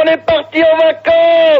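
high, slow joyful shout; excited shout. Real, not acted. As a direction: shout out the holiday. Celebrating the holiday win. (cri de joie aigu)

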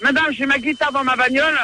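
normal talking voice

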